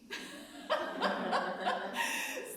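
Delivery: high unvoiced burst of laughter; big laugh. Real, not acted. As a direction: laugh out loud at the irony. Laughing at Laban's threat.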